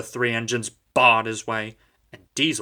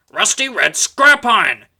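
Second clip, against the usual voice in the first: loud, higher spiteful complaint; hissed; 10 semitones higher, 11 dB louder than usual. A narrator's reading. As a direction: spit out the insult. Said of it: "hissed James".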